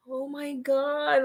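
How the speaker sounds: slow, high, hushed awe; retold amazement watching in bed; amazed gasp. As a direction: breathe it out in disbelief. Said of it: "I was like".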